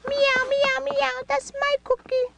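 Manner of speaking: high meowing cat voice; kitty voice. Pretend. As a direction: squeak it like a playful kitten. Voicing the cat toy.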